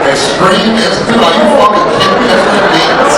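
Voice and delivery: high, loud disbelieving yell; high indignant outburst; outraged disbelief. Real, not acted. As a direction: shout it like you've been cheated. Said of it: "the most disappointing shit I've ever heard".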